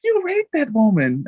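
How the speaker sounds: hammed-up offended voice; mock outraged women. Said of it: "what women be saying".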